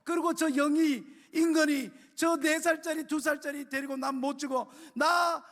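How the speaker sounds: high-pitched mother begging her own mother; desperate tearful pleading. Retold or imitated. Mother begging grandma to take her to church, tearful plea. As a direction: plead through tears, voice climbing and breaking. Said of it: "막 자꾸 난리를 피웠는데".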